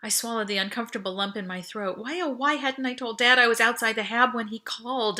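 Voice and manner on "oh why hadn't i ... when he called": ordinary delivery, nothing marked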